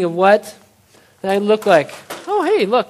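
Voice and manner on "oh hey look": mock surprise. Pretending to discover a ladder.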